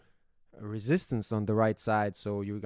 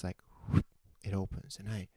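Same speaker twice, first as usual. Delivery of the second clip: ordinary talk, nothing marked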